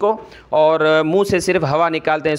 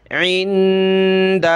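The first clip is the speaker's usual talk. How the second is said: slow blended demo; much slower than usual. Shown as a demonstration. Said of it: "کیا بنا؟".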